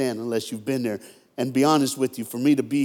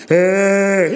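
mock wail, loud and held out; ugly boy cry; 4 semitones higher, 6 dB louder than usual. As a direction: bawl one long exaggerated note. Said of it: "that ugly boy cry".

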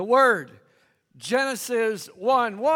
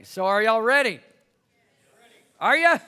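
rousing call; 5 dB louder, faster than usual. Real, not acted. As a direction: call it out to get the room answering. Rousing the congregation for an answer.

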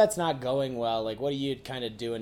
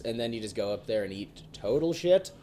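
usual speaking voice

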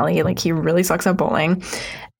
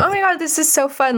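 imitated giddy dog, higher voice; a touch of gleeful excitement, pitched up; delighted; 8 semitones higher than usual. Dog thrilled that hypnotizing Cat works, delighted.

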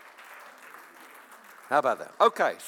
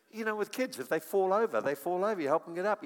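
usual speaking voice

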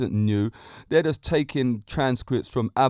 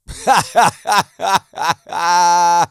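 big burst of real laughter; loud laugh; 4 semitones higher, 7 dB louder than usual. Real, not acted. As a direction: crack up loudly at your own pun. Laughing at his own joke.

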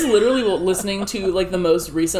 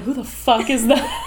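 laughing shout; 5 semitones higher than usual. Retold or imitated. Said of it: "And it's like".

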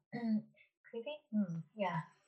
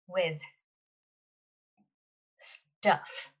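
detective voice; 11 dB louder than usual. Acted. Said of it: "Best detective voice".

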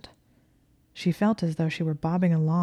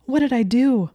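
high, loud, panicked question; shocked, raised-pitch outburst; aghast; 7 semitones higher, 7 dB louder than usual. A narrator's reading. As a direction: cry out in alarm at yourself. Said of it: "she gasped, hurrying over".

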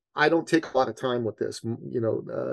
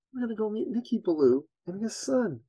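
put-on mother to child; 9 semitones higher, 4 dB quieter than usual. Mother answers her little boy.

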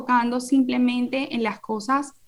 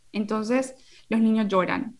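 usual speaking voice

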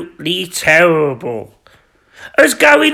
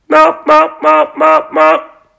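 mock knocking; 6 semitones higher, slower than usual. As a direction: voice the door knocks as "mop". Knocking sound at door.

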